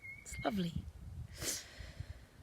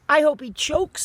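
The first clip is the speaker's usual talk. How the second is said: much higher and louder, spiteful; angry outburst; 9 semitones higher, 11 dB louder than usual. A narrator's reading. Sophie cursing the giant who wants to eat soldiers, furious. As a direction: spit it out in fury.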